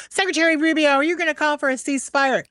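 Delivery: high-pitched mousy woman confronting; mousy activist. Retold or imitated. Activist gets in the secretary's face, high mock voice. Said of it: "She's this mousy little woman".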